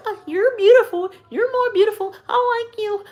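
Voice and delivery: very high, gushing flattery; mock reassurance. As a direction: coo exaggerated compliments in a squeaky voice.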